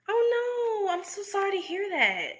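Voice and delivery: high, soft, caring voice; soft sympathy. Real, not acted. Reacting to a viewer's bad news, soft high sympathy. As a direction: comfort a viewer gently.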